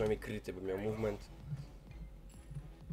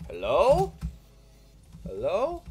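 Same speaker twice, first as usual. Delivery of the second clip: incredulous hello; 11 semitones higher, 9 dB louder, much slower than usual. Real, not acted. Calling out to teammate.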